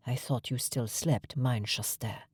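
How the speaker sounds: soft, low, tender voice. A narrator's reading. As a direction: hush it warmly to her. Eric tenderly greets her.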